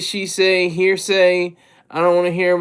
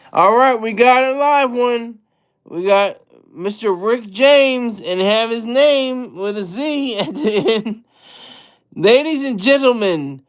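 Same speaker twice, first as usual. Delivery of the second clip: playful announcer; 2 semitones higher than usual. Pretend. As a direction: introduce a guest like a showman, laughing through bits. Announces a viewer like an emcee.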